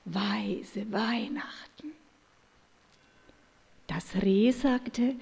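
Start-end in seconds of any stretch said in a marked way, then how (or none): 0.0-1.9 soft enraptured whisper, dreaming aloud; rapturous whisper. A narrator's reading. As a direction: breathe it out in delight. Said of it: "Und er schwärmte verzückt".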